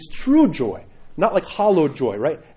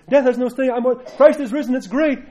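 higher, breezy boasting; mock glib triumph; 8 semitones higher, 4 dB louder than usual. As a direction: toss off the victory words too easily. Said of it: "It's easy to say those words".

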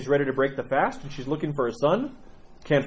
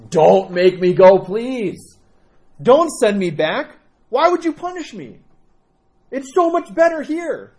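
pleading; 7 semitones higher, 8 dB louder, slower than usual. Acted. Son clings to saint's legs.

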